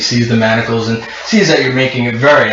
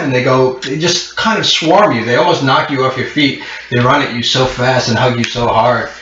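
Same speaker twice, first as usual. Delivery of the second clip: normal talking voice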